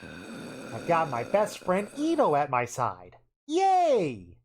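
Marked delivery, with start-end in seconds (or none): 3.5-4.4 excited. Real, not acted. Cheering over going to space.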